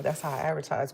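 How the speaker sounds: ordinary delivery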